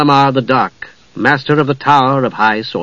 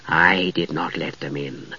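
low, soft hollow spectral voice; ghostly spectre voice; 9 semitones lower, 10 dB quieter than usual. A narrator's reading. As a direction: speak like a cold ghost. Spectre speaks.

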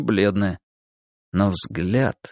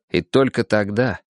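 ordinary delivery

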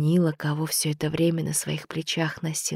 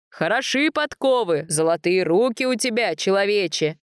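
put-on jovial old god; 4 semitones higher, 3 dB louder than usual. A narrator's reading. God praising the blacksmith.

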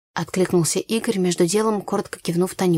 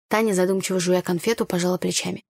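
ordinary delivery

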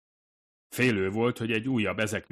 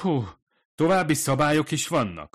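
higher, louder indignant exclamation; exasperated outburst at more rules; bursting out; 8 semitones higher, 7 dB louder than usual. A narrator's reading. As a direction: blurt it out in disbelief. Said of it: "fakadt ki".

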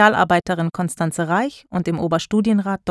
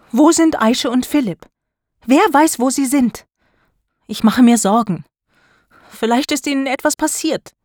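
raised, louder, urgent worry; worried; 5 semitones higher, 4 dB louder than usual. A narrator's reading. Konstanze demanding where the missing pupils are, worried. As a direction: press the questions anxiously, pitch jumping up. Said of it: "Konstanze ist besorgt".

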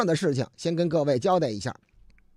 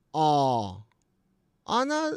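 ordinary delivery

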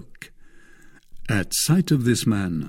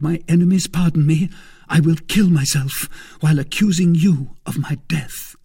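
higher, vehement woman's voice; earnest young woman; 6 semitones higher, 4 dB louder than usual. Acted. Young woman warning her protector before God, solemnly vehement. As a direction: swear it solemnly, pitched up.

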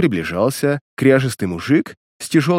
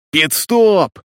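sharp yelled call, higher; 6 semitones higher, 3 dB louder than usual. Acted. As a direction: bark it out as a shout. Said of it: "заорал главарь".

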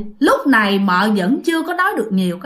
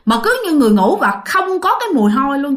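usual speaking voice

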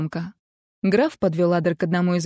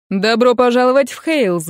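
loud hearty welcome; exclaiming; 4 semitones higher, 6 dB louder than usual. A narrator's reading. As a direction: call out the welcome warmly. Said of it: "воскликнул Патрик".

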